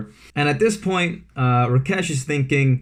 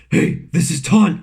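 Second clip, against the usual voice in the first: a light mock Batman voice, a touch higher; Batman impression; 4 semitones higher, 4 dB louder than usual. Prank caller again as Batman to Rakesh, mock growl. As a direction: repeat the fake Batman line. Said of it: "I said".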